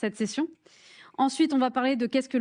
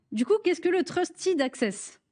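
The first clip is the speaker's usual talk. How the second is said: French accent; 7 semitones higher, slower than usual. Shown as a demonstration. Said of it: "Avec mon super accent, euh, accent français".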